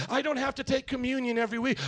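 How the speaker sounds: normal talking voice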